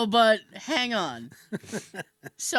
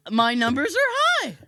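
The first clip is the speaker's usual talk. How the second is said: loud, higher-pitched mock exasperation; exasperated retort; 5 semitones higher, 5 dB louder than usual. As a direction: throw it back at the nurse, louder and higher.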